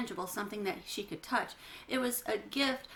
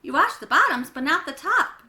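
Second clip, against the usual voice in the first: loud, high, indignant protest; annoyed complaint; 6 semitones higher, 11 dB louder than usual. Acted. Janie complaining to Mom about her pajama top, loud and indignant. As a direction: push back hard, stressing top.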